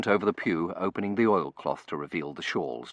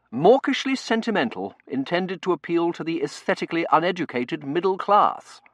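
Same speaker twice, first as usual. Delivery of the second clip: high, loud, emphatic verdict; forceful servant giving his opinion; blunt butler Bain; 11 semitones higher, 7 dB louder than usual. Acted. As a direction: pronounce a damning critique with full conviction.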